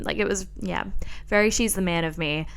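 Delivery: normal talking voice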